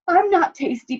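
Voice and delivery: Dog begging the wolf not to eat her, pleading. A narrator's reading. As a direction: beg for your life. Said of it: "pleaded Dog".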